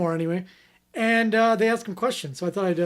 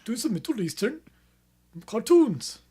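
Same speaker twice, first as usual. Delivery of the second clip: mock Indian accent; 3 semitones higher than usual. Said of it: "Everyone just does an Indian accent".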